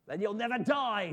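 loud, high, snappish comeback; snapped retort. A narrator's reading. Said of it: "snapped Phyllis".

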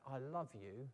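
low, soft, simple declaration; Lucy's first quiet declaration. Acted. Lucy first telling Charlie Brown, quietly. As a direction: say it softly, before any shouting. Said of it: "each time Lucy says it louder and louder".

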